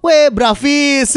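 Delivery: slow, girly mock greeting. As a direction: imitate girls greeting. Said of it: "Kalau cewek ngumpul".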